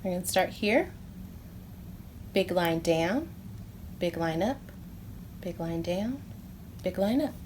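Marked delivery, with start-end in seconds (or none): 2.3-7.3 calm handwriting count; guiding the writing. Shown as a demonstration. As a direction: guide little hands line by line. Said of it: "Let's do it together".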